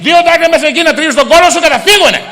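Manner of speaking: grandmother scolding her grandson that this cure works; Yaya insisting. Retold or imitated. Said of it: "she's sitting there and goes".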